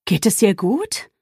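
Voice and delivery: slow question, rising at the end; lightly voiced concern, drawn out; worried neighbour. A narrator's reading. Neighbour asking after the woman, worried. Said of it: "fragte der besorgte Nachbar".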